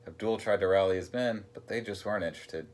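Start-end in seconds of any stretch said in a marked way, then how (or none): none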